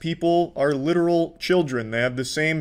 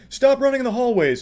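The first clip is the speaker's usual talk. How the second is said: high-pitched officious scolding, imitated; mock hall monitor; 8 semitones higher than usual. Commentator mocking a man chasing after them, hall-monitor voice. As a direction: call it out like a prissy hall monitor. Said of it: "just like a hall monitor".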